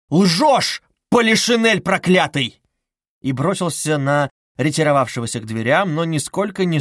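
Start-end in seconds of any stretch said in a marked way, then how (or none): 0.1-2.6 loud, high, hurled insult; furious yell. A narrator's reading. Suspect cursing mocking investigator, furious yell. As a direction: spit the accusation out at full voice. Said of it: "завопил Раскольников, уже не удерживаясь".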